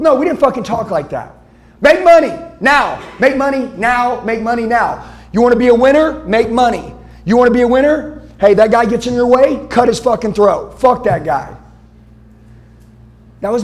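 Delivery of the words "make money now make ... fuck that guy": loud, high, aggressive drill-sergeant bark; imitating old leaders. Speaker imitating his old leaders ordering him to make money. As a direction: hammer it like a ruthless boss. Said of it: "My leaders told me".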